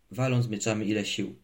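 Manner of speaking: ordinary delivery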